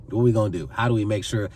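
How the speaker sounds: ordinary talk, nothing marked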